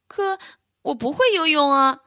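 high, worried fawn voice; scared fawn. A narrator's reading. Fawn afraid of the river protests to the bear, high and scared. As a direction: shrink back from the water. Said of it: "奥利弗一见水就哆嗦起来".

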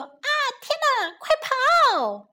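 very high panicked squeak, slow run away; tiny wasps squealing in panic. Acted. Scared wasps fleeing, very high squeals.

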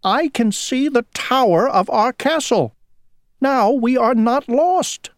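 put-on high, excited young prince; excited cry. A narrator's reading. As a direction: announce the discovery with rising excitement. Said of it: "he cried".

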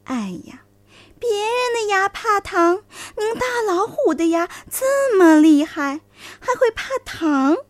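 high coaxing sly fox; flattering fox voice. A narrator's reading. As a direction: flatter him out of worry. (狐狸奉承地哄骗)

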